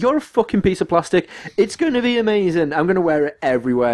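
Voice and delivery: high-pitched, defensive enthusiasm; excited comeback. Retold or imitated. Defending his plastic gadget to a workmate, excitedly indignant. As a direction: replay an indignant, gushing retort.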